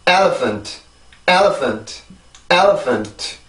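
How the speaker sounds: "elephant" in English rhythm, falling away after "el"; pronunciation drill. Shown as a demonstration. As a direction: model "elephant" with stress on the first syllable. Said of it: "이렇게 해서 이 단어가 발음이 돼요".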